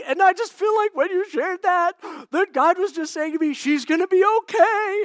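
loud, high, moved outpouring; overwhelmed. Retold or imitated. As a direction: pour out the relief all at once. Father moved by the message.

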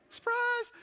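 mock surprise. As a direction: squeal it like a joke surprise.

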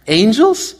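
a baffled, rising Angels?; puzzled question. Real, not acted. Puzzled over the verse, a mock-baffled rising question. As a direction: stop and ask Angels? in disbelief.

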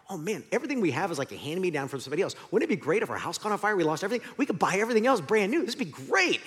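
fast, gushing enthusiasm; retold excitement. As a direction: rattle off a daydream, pitch climbing. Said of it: "I was all excited".

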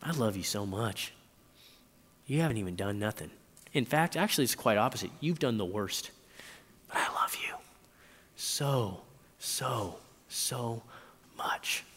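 soft and hushed, sinking to a whisper; tender love. Retold or imitated. Jesus speaking to a sinner, softly and tenderly. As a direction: pour out tender love, quietly. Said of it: "Jesus looks at us like".

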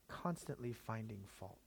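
repeated quietly for weight. Shown as a demonstration. Preacher repeating the point to the congregation, quietly. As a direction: let the phrase sink in softly.

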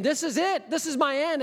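the prophet sure he is finished; Jonah's despair. Acted. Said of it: "Jonah's thinking".

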